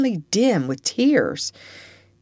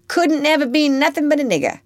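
put-on high, louder child's retort in dialect; defiant Topsy; 11 semitones higher, 4 dB louder than usual. Child interrupts Eva's plea to be good, defiant. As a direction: cut in as a stubborn, hopeless child.

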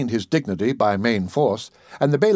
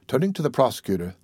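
usual speaking voice

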